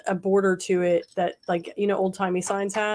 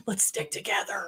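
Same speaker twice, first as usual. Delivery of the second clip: whispering; 8 dB quieter than usual. Real, not acted. Whispered aside to crowd.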